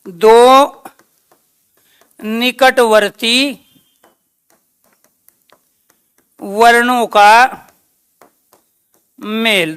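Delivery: slow, word by word with long pauses; slow dictation. Shown as a demonstration. Teacher dictating a definition slowly to the class. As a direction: dictate it slowly so they can write. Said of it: "दूसरा question क्या है इसका?".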